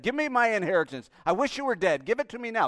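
loud, grasping demand; demanding younger son. Acted. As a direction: demand it coldly. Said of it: "the younger brother said".

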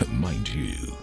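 usual speaking voice

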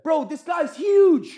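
high, loud and stretched out; drawn-out, high-pitched disbelief; astonished shout. Real, not acted. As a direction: shout it slowly in amazement. Exclaims over the attacker's size.

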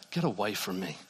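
low, soft acted pushing away; subdued hurt rejection. Upset believer pushing God away, hushed and understated. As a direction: quote the hurt believer quietly, holding the anger down. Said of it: "in our anger and our hurt and our upset".

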